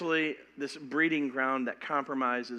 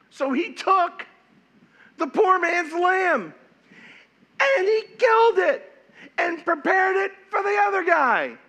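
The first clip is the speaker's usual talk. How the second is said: loud and high, building to a shout; dramatic shout; 8 dB louder than usual. Acted. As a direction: hit the killing with outrage. Climax of the parable.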